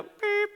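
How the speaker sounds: high mock intercom beep. Teller mimics the school intercom beep. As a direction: squeak out the announcement tone.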